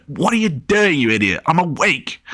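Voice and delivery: a groggy sister scolding her brother; annoyed. A narrator's reading. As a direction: tell him off, fed up. Erin snaps at her brother.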